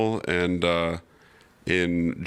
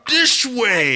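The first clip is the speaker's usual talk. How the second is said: much higher, louder demand; mock ego shout; 7 dB louder than usual. Guest joins mocking the egos with a loud, high shout. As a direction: bellow it like a diva. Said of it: "we were all like".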